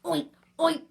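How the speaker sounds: two short, loud, high oinks; pig oink. A narrator's reading. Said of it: "grunted the pig".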